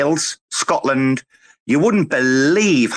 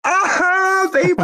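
triumphant whoop; slower than usual. Real, not acted. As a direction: celebrate the pun like a goal. Celebrating own pun.

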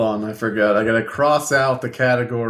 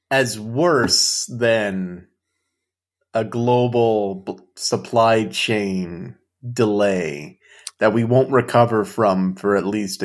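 slow and deliberate; slower than usual. Real, not acted. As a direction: draw out each word slowly.

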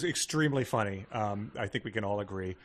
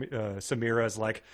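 normal talking voice

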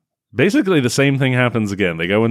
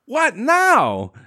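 a hint of a whiny hero; 13 semitones higher than usual. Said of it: "He says to her".

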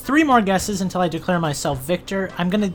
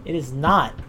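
ordinary delivery

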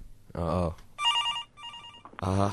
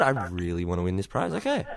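usual speaking voice

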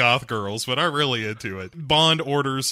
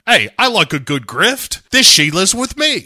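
louder, higher cocky character voice; Bond; 6 semitones higher, 6 dB louder than usual. Retold or imitated. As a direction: announce it boldly as a cartoonish hero. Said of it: "Bond here is like".

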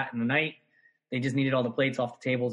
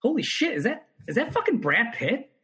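high-pitched retold shock; stunned disbelief; 8 semitones higher than usual. Bartender spots a celebrity, high stunned disbelief. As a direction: blurt it out, star-struck. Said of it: "and I was like".

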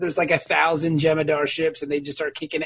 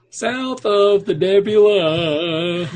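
slow drawn out; 4 dB louder, much slower than usual. Pretend. Slow echo of joke.

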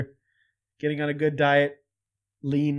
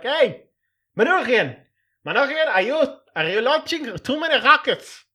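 higher and louder, mock-alarmed official; mock president's office call; 7 semitones higher, 6 dB louder than usual. Vlogger voicing the president's office calling Manoogian, loud mock alarm. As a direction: yell it like a panicky bureaucrat on the phone. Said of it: "I'm terrible".

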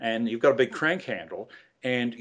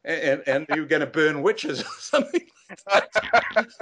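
higher, lightly voiced amazed trainee; 5 semitones higher, 3 dB louder than usual. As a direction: play the baffled trainee, higher. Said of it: "He said".

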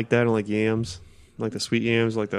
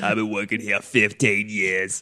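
waitress voice. Retold or imitated. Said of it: "the Waffle House ladies are always like".

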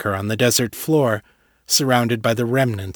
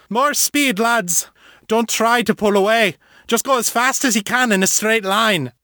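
urgent command; 10 semitones higher than usual. Acted. Liam urges crew to flee.

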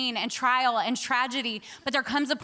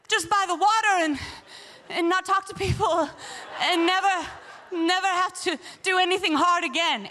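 higher-pitched comic pleading; mock pleading; 4 semitones higher than usual. Speaker asking the Lord for an easier city, mock pleading. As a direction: beg half-jokingly, drifting into a daydream.

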